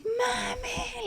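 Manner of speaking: high, held cry, kept soft; imitated child's scream. Mom imitating her son at the dentist, soft high scream. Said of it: "like, screaming".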